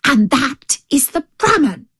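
tiger's emphatic lesson for a dunce; exasperated tiger. Acted. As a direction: spell it out impatiently.

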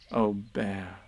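low, tender address; fond. Acted. Christopher Robin to Pooh after the fright, fond and low. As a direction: soften it with affection.

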